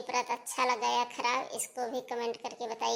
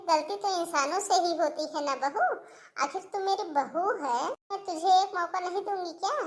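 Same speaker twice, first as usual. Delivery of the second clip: forgiving mother-in-law; 5 semitones higher than usual. Acted.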